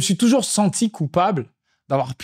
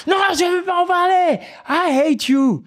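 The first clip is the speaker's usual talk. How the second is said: put-on very high shrieking child refusal; screaming toddler. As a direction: shriek it like a furious three-year-old.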